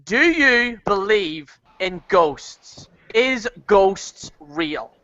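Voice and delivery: slow, spooky emphasis; slow Halloween quiz voice; drawn-out host question. Acted. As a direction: stretch it out like a ghost host.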